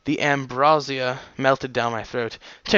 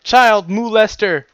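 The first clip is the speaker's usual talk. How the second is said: slow, raised mock-accusing parent voice; outraged parents; 6 semitones higher, 4 dB louder, slower than usual. Parents accusing the author over the child, mock outrage. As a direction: draw out a high, mock-horrified accusation. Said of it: "the parents are like".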